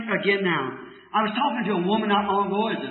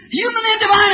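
much higher, louder incredulous question; a higher, louder imitation of the scoffing woman; scoffing disbelief; 12 semitones higher, 4 dB louder than usual. Woman scoffs at his answer.

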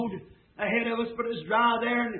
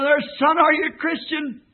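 hollering; 5 semitones higher, 4 dB louder than usual. Retold or imitated. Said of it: "I hollered".

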